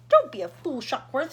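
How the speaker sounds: loud impatient snap; an irritated loud rebuke; snapped. A narrator's reading. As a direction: cut him down sharply. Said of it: "snapped ground control".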